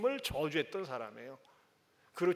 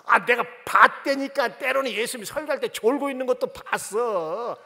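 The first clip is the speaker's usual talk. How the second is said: put-on girl insisting; 5 semitones higher, 10 dB louder than usual. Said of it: "또 부를 때".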